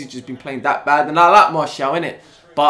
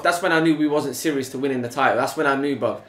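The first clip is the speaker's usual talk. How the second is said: ordinary talk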